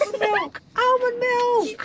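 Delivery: high thrilled squeal; squealing delight. Real, not acted. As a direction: shriek it with joy. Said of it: "So surprised".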